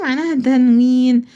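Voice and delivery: ordinary talk, nothing marked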